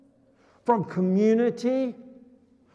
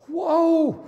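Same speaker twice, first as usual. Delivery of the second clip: surprised; 7 semitones higher, 4 dB louder than usual. Pretend. Leper noticing his healing.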